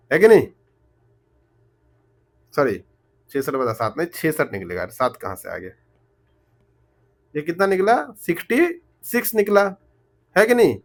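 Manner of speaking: ordinary delivery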